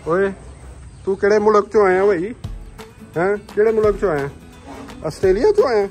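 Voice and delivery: loud, high mock questioning; playful interrogation. Jokingly quizzes cattle in Punjabi. (ਉੱਚੀ ਆਵਾਜ਼ ਵਿੱਚ ਮਖੌਲੀਆ ਪੁੱਛਗਿੱਛ)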